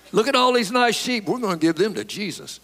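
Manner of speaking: high-pitched, falsely innocent Saul; mock excuse-making. Preacher mimics Saul excusing his disobedience with the sheep, mock excuse-making. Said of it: "he's making excuses for what he done".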